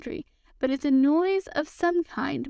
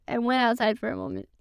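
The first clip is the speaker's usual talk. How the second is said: ordinary delivery, nothing marked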